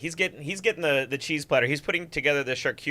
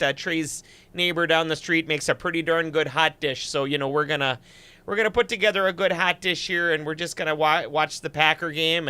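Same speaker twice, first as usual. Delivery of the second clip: Superior Wisconsin accent; 2 semitones higher than usual. Shown as a demonstration. Host greeting stream viewers as a small-town Wisconsinite, Wisconsin accent. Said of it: "Superior, Wisconsin accent for you".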